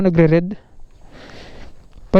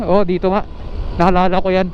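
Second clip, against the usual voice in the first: ordinary talk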